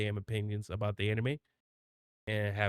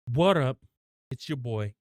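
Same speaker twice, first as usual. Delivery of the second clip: YouTuber intro voice; 7 dB louder than usual. Shown as a demonstration. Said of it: "this is sound too close to Dashy".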